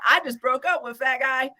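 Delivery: ordinary delivery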